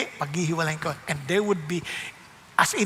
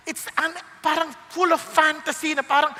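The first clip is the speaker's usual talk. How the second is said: normal talking voice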